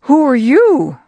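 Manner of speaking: put-on high, loud startled question from a blind old father. Blind Isaac startled by a visitor, calling out high and loud. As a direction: blurt it out high and loud, caught off guard. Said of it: "And Isaac said".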